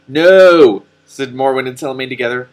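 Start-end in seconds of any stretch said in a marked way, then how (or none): 0.1-0.8 loud joint no. Acted. Morwen and Telemaine shouting no at the donkey. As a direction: shout no, much higher and louder.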